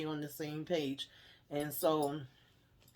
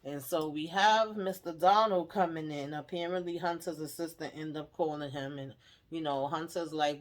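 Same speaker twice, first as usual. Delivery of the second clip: normal talking voice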